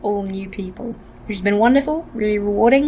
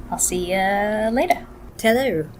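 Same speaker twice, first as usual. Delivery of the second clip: usual speaking voice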